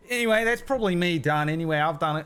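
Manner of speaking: high, loud, quick cockney goodbye; chirpy cockney rogue bowing out of the game; high cockney halfling. Acted. Halfling cheerily quits the card game, high cockney. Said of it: "the halfling says".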